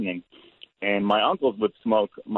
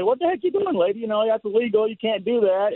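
much higher, lightly voiced scolding policeman; mock cop scolding; 12 semitones higher than usual. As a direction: play the cops telling off an old lady, hammed up. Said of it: "they say".

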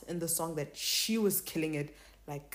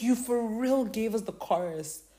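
higher-pitched mock indignation; retold outrage; 5 semitones higher than usual. Fan retelling reaction to the spoiled chorus, mock outrage. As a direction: replay your disbelief, pitched up. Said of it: "I was so mad".

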